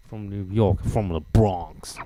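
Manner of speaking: mock Bronx accent, drawn out; New York accent. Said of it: "New York is like its own language".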